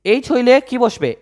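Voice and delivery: ordinary talk, nothing marked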